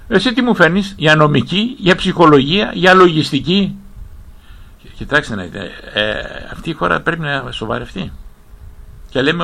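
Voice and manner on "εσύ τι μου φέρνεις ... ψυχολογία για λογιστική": raised, louder rhetorical questioning; indignant challenge. Acted. Professor grilling an imagined foreign university on its offerings, indignant. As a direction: confront them, pushing each question louder.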